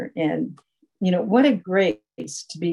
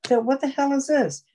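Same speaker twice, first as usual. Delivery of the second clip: pitched way up, baffled; startled disbelief; 9 semitones higher than usual. Retold or imitated. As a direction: blurt it out, thrown by the sudden stop. Sudden surprise at grocery store.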